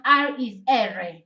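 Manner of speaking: first "R" English "ar", second "R" Italian "erre"; Italian letter name. Shown as a demonstration. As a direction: end on "R" said as two syllables, "EH-reh". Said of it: "So this is pronounced".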